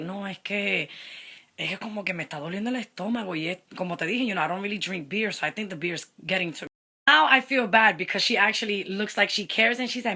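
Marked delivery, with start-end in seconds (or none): 0.0-6.7 low, softened excuse-making; feigned stomach ache. Retold or imitated. Dater making an excuse to leave, mildly faked ache. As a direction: drop low and soft, lightly faking feeling ill. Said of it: "make it seem like I had a stomach ache".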